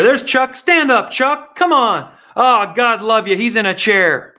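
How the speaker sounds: mocking Biden urging Chuck to stand; imitating Biden. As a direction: cheer on a man who can't stand. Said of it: "he asked the guy in a wheelchair".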